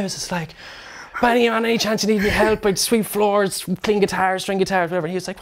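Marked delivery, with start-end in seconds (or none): 1.2-5.1 eager pleading. Retold or imitated. Pleading for job.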